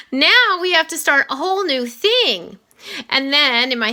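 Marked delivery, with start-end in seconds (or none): 0.1-2.6 exasperated whine. Retold or imitated. Boy thinking in class about another project, high exasperated whine. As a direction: groan it out like a kid buried in homework. Kid groaning inwardly at new project.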